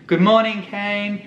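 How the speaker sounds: singled-out, high-pitched mock hello; greeting from a stranger. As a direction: imitate someone cheerily greeting a camera. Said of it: "someone that he knew and say".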